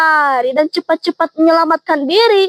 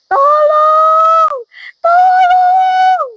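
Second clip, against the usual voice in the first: calling for help; 9 semitones higher than usual. Acted. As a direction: scream for rescue as the water rises. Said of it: "meminta tolong kepada siapapun". (teriakan minta tolong yang panik dan tinggi)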